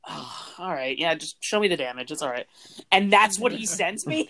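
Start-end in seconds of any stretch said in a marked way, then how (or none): 0.1-2.5 low, soft, unbothered reply; calm. Retold or imitated. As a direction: play it calm and reassuring. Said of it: "calmly being like".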